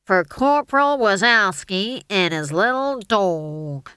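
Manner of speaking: slow, loud army sergeant rasp. A narrator's reading. Stage manager as tin-helmeted officer, slowly rasping. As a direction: rasp it out round a cigar. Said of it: "and rasped".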